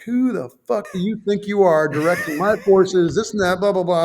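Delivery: mock yell of a boss tearing into him; retold chewing out. Officer chewing out a captain on the phone, imitated angry. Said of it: "never gotten my ass ripped".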